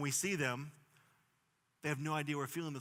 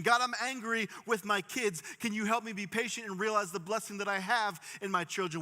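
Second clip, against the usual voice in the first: higher, louder pleading prayer; fervent prayer voice; earnest prayer; 6 semitones higher, 5 dB louder than usual. Shown as a demonstration. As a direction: cry out to God for help. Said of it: "we need to pray".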